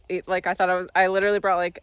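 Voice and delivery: ordinary talk, nothing marked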